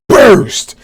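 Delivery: sharp high shout of the attack name; shouted attack call. Retold or imitated. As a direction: belt out the move name. Attack name mid action.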